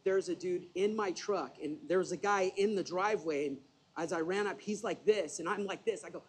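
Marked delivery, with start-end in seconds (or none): none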